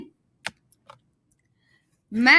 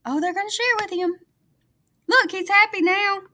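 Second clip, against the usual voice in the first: delighted; 7 semitones higher, faster than usual. Real, not acted. Teacher delighted for kids.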